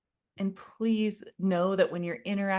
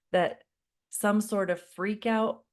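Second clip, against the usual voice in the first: ordinary talk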